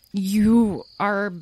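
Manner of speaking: halting, dazed politeness; managed with effort. Acted. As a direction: force out polite words while stunned. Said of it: "Tom finally managed".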